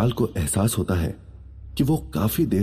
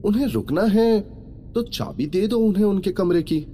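high-pitched woman's voice, admonishing; reproving woman; 12 semitones higher than usual. A narrator's reading. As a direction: correct a staff member in a high voice. Mallika reproves Vishal.

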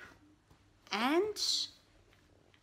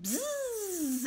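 bee buzz; 9 semitones higher than usual. Acted. Bee flies off.

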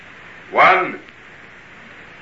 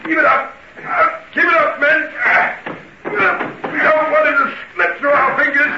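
shouted orders; 11 semitones higher than usual. Acted. Inspector shouting orders at the men breaking the door.

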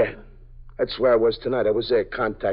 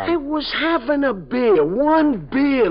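high, slow pleading whine; whining protest; 13 semitones higher, 3 dB louder, much slower than usual. Acted. Suspect protests to the lieutenant, high slow whine. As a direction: plead your innocence, pitched up.

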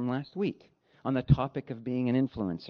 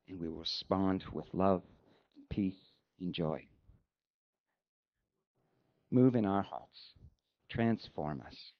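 lower, unhurried prayer voice; closing prayer; 6 semitones lower than usual. Acted. Pastor leading the congregation in closing prayer, reverent and lower.

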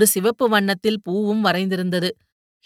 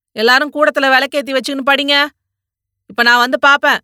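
put-on louder, commanding scolding of the boys; bossy shout; 4 semitones higher, 7 dB louder than usual. A narrator's reading. Father shouting at his sons to go study, commanding yell. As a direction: bark orders at the kids to go study. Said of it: "என்று அதிகாரமாக கத்தினான்".

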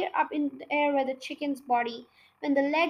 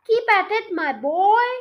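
a louder, eager call to keep going; excited cry; 4 semitones higher, 6 dB louder than usual. A narrator's reading. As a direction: cheer the boy on, dancing with glee. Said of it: "cried Mister Cranky".

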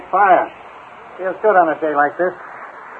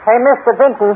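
calling out; 8 semitones higher than usual. Acted. Inspector calls to a man at the gate, calling out. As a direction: call out across the yard, pitch lifted. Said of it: "Call to him, Inspector".

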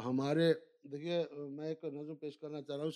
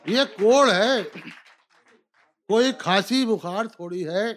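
rising, declaimed couplet; poetry recitation; 3 semitones higher, 10 dB louder than usual. Acted. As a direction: declaim it to a live audience. Recites couplets, each repeated.

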